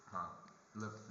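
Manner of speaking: ordinary talk, nothing marked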